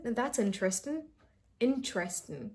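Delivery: interesting as "in-tresting"; interesting without the "ter"; one go. Shown as a demonstration. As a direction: say interesting in three syllables. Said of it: "Just say it in one go".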